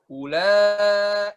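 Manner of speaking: "Ula" with a long, steady final vowel; clean steady tone. Shown as a demonstration. Teacher demonstrating a clean voice, steady held "Ula". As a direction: hold the "la" of "Ula" smooth and unwavering. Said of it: "itu kan bersih ya".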